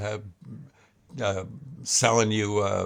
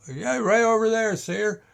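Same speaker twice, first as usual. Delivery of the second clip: put-on kid pointing out; 10 semitones higher than usual.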